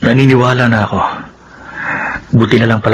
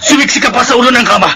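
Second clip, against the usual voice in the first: furious threat; 13 semitones higher than usual. Acted. Armed husband threatening his wife, furious. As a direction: bark the threat at her.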